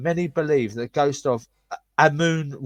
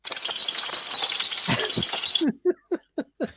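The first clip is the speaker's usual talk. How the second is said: high breathy giggle; 5 dB louder than usual. Real, not acted. Giggles at own posh attempt.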